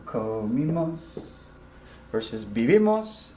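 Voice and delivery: comimos said slowly and softly, ko-MEE-mos; slow Spanish. Shown as a demonstration. Teacher contrasting comimos and vivimos slowly. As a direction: draw out comimos then vivimos. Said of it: "Here in blue".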